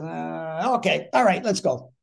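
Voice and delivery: skim it, then push everyone on; hurried Mickey Rooney. Retold or imitated. Mickey glances at his script, then briskly takes charge. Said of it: "he goes like this".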